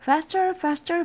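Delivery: very high, loud urging cry; urgent cry. Acted. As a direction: cry out, pushing yourself on. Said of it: "cried Owl".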